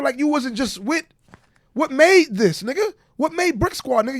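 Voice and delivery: ordinary delivery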